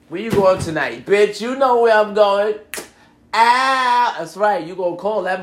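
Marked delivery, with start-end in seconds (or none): 0.1-2.5 put-on loud, higher girlfriends' banter; rowdy acted exchange; hyped-up night out. Acted exchange between friends going out. 3.3-4.1 loud, high, stretched-out whoop; gleeful drawn-out shout; good-time holler. Shown as a demonstration. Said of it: "Say it like that".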